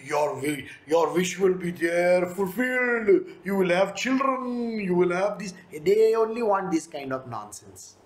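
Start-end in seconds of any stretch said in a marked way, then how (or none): 0.1-5.6 mock guru blessing. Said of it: "If I tell them".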